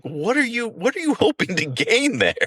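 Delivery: high, mocking question; mocking challenge. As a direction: needle him for jogging. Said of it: "He was definitely going".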